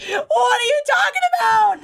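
high, loud cry of protest; loud outcry. Real, not acted. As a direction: shout in disbelief. Reacting to Nesta's choice.

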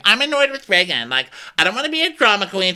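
very high, loud whiny complaint; mock annoyed. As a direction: imitate a dramatic, put-out guest. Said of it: "is very annoyed".

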